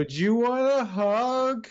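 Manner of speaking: put-on high, slow slurry drunk; drunken voice. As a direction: slur it out like a happy drunk. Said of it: "a very drunken voice behind you go".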